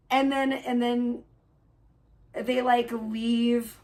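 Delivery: ordinary talk, nothing marked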